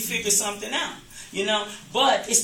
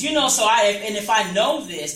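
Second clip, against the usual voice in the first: normal talking voice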